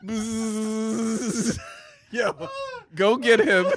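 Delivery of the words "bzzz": long higher-pitched buzz; imitated bee buzz. Host mimics the angry Beehive arriving, long bee buzz. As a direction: buzz like an approaching swarm.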